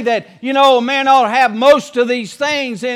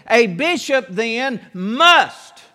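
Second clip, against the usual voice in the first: "A bishop then must." said slowly, leaning hard on "must"; stress on must; much slower than usual. Shown as a demonstration. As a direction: drag it out and land heavily on "must". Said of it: "You ought to underline that word".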